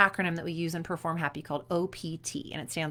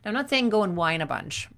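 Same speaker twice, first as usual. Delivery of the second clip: ordinary delivery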